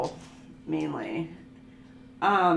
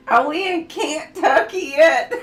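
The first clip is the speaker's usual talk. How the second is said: put-on high, loud whiny child asking from the back seat; a kid begging to know if they've arrived; impatient kid in the car; 8 semitones higher, 11 dB louder than usual. Daughter asking her mom in the car, whiny high child voice.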